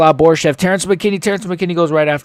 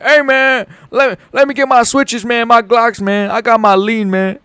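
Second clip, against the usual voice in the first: a hammed-up mock thug, higher; higher, faster mocking voice of a bragging fighter; mocking impression; 7 semitones higher than usual. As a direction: sneer at a rival with a dumb caricature. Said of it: "like, yapping bullshit".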